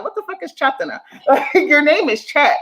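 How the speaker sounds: real laughter at a ridiculous name; laughing in disbelief. Real, not acted. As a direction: laugh your way through the question. Laughing at the Chatana quote.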